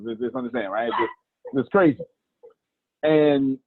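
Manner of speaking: ordinary delivery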